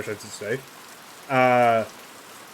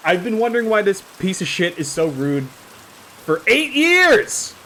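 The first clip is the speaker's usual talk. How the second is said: loud, slow, building pretend rant; hammed-up furious shout; mock yell; 6 semitones higher, 6 dB louder, slower than usual. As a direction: rant at the rude monk, peaking on years. Mock rage at rude game character.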